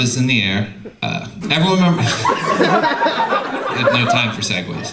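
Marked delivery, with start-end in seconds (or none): 2.1-3.9 real laugh. Real, not acted. Laughs at own segue.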